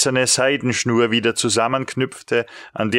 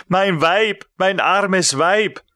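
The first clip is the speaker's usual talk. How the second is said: higher, slow cry of despair; a higher, slow anguished outcry; 7 semitones higher, slower than usual. A narrator's reading. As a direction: cry out for your wife, drawn out. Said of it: "schrie ich".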